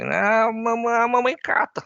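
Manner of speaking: put-on high, loud childish voice; cheeky daughter whining back at dad; little girl voice. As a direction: imitate a cheeky five-year-old girl. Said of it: "A menina que é mais tinhosa chega falando assim".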